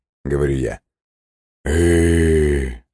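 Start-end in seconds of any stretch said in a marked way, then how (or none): 1.6-2.8 long heavy sighing "eh"; heavy sighing groan. Acted. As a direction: groan it out on a sigh. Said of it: "тяжело вздыхая".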